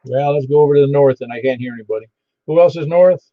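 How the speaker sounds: usual speaking voice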